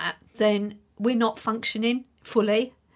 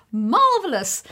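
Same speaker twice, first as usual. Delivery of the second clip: "Marvellous" punched out higher and louder, falling at the end; emphatic marvellous; 6 semitones higher, 8 dB louder, slower than usual. Shown as a demonstration. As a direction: give "Marvellous" a big theatrical emphasis. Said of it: "can't say the word marvellous without saying it with some kind of emphasis".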